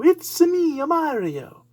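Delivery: high, loud Mario voice; quick cartoon plumber impression. Shown as a demonstration. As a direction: toss off the Mario catchphrase like anyone could. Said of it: "Everybody can do".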